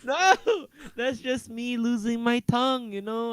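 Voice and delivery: high-pitched mock complaint; playful whining. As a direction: whine it high, half laughing. Mock whining under teasing.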